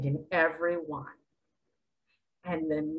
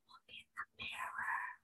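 hushed whisper to the listener; secretive whisper; 20 dB quieter than usual. Real, not acted. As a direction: lean in and whisper it. Preacher challenges listener.

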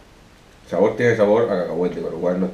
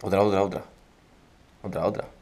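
words thick from a parched mouth; dry mouth; 7 dB quieter than usual. Real, not acted. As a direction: talk with a mouth stuck dry. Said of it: "Intenta hablar".

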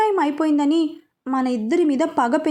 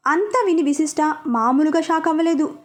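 ordinary delivery

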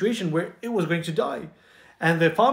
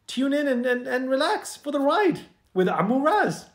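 sing-song invitation; 7 semitones higher than usual. Acted. Host invites listeners to relax.

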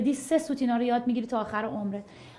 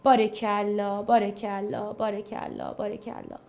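soft praise trailing away, each one quieter; fading bravo; 5 semitones lower, 7 dB quieter than usual. Real, not acted. Teacher praising the class, each bravo softer. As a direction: let each bravo fade a little more. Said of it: "praising the class, fading out".

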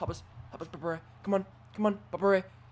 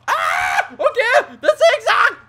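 sudden high yell of alarm; real shocked shout; 13 dB louder, much slower than usual. Real, not acted. Gamer yelling as Ziggler hits the zigzag, shocked. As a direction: cry out as the finisher lands. Gamer yells at opponent's finisher.

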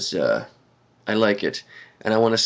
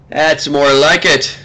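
sipping coffee; much slower than usual. Real, not acted. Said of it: "Let me sip my coffee".

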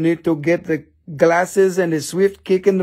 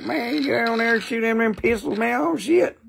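imitating wife; 8 semitones higher than usual. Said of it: "She's like".

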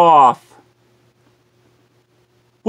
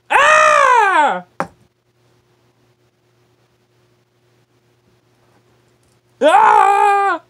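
high scream; 12 semitones higher than usual. Pretend.

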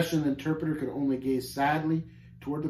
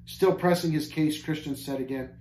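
plain reading voice

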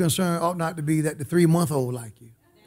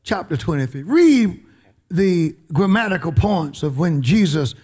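normal talking voice

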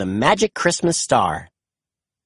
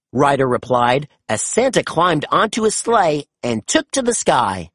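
ordinary delivery

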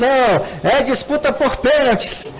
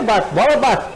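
ordinary talk

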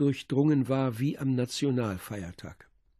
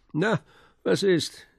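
brisk, higher-pitched, chummy question; hearty prodding; 6 semitones higher, slower than usual. A narrator's reading. Robust commissioner straddling a chair before the sick doctor, heartily prodding. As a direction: prod him with a light, high "well?".

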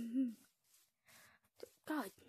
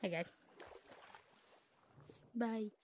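usual speaking voice

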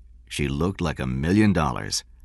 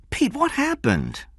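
anxious woman's voice. A narrator's reading. Narrator voicing Diane.

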